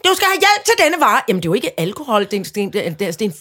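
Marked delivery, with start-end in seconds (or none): 0.0-1.2 high, loud mock machine announcement; self-checkout machine. Till refusing a customer's item, imitated machine voice. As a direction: imitate a nagging checkout alert. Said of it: "så siger den".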